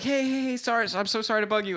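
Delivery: high-pitched, hurried imitation of an apologetic interrupter; mock an insistent fan flagging someone down; eager stranger. Acting out insistent stranger stopping her.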